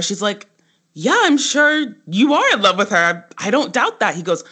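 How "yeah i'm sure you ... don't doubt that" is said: higher, dry sarcastic reply; mock the guy's big declaration; sarcastic Tess. Tess brushing off Max's love talk, sarcastic and higher.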